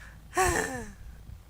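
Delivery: high-pitched kobold sigh; acted sigh. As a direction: sigh heavily in character. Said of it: "he just sighs again and goes".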